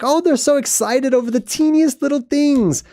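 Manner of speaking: high-pitched, hammed-up condescension; uppity God. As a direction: act haughty and tickled by tiny creatures. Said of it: "God gets all uppity about it".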